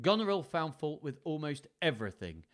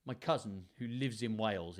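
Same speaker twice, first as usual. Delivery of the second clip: usual speaking voice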